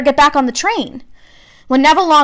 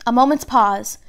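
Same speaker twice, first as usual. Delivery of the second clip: ordinary delivery, nothing marked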